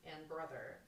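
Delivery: normal talking voice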